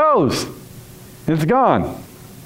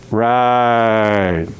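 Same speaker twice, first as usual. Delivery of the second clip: ordinary delivery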